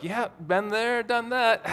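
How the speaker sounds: slow, high drawl ending in a sigh; mock jaded. Speaker imitating seasoned parents, mock jaded. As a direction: draw it out like an old hand.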